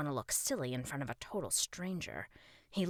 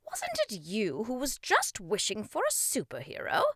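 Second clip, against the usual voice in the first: higher, louder theatrical king; questioning king; 4 semitones higher, 6 dB louder than usual. Acted. King reminds boy of his wish, theatrical questioning. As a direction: put the challenge grandly.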